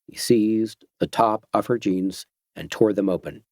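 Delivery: ordinary delivery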